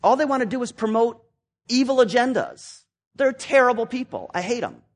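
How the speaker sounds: angry rant. Retold or imitated. Self-justifying conservative ranting about liberals, angry. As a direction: rail against them, heated and scornful. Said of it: "you can say".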